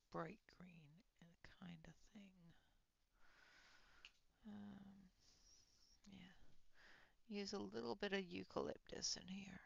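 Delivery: soft whispered painting talk. Real, not acted. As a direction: murmur to yourself over the canvas.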